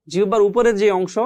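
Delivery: normal talking voice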